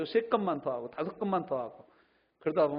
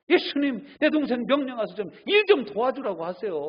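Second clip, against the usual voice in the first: higher, louder bossy complaint; bossy demanding Martha; 8 semitones higher, 7 dB louder than usual. Retold or imitated. Martha ordering Jesus to make her sister help, bossy whine. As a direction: boss Jesus around like a sister-in-law. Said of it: "예수님 보고 막 시키잖아".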